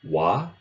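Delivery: teacher modelling the Blackfoot ending "wa"; final wa demo. Shown as a demonstration. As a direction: pronounce the word-final "wa" plainly. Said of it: "two sounds ... at the end of words".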